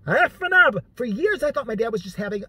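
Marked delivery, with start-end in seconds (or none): 0.1-0.8 high, loud mock exclamation of the word; imitating a fake Italian dad; dad's catchphrase. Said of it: "he used this one".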